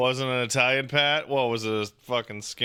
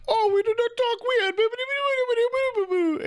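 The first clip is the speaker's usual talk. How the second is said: squeaky falsetto, much higher; mock Italian reply; much faster than usual. Streamer voicing Italians who say they don't talk weird, in a squeaky falsetto. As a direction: voice the offended Italians up in a tiny high squeak. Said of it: "And then they're like".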